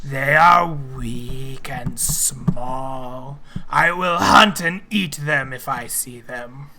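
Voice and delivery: slow, whispered beast voice; creature voice. Acted. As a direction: rasp it out slowly like a predator. GM voices giant mink.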